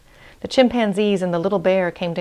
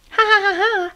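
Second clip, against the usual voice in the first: high mocking ha-ha laugh; staccato fake cackle, pitched way up; hyena's laugh; 3 dB louder than usual. A narrator's reading. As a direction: shriek of put-on laughter, far above the usual voice. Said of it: "said the hyena".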